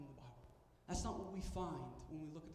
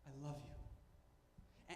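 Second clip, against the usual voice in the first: very slow, breathy whisper; whispered I love you; 3 semitones lower, 9 dB quieter, much slower than usual. Retold or imitated. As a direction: let it barely leave your lips, slowly. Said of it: "he always begins by saying".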